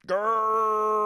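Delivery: high, loud, drawn-out cry; mock roar. Co-host echoes the running joke, drawn-out mock cry. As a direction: belt the word out in comic horror.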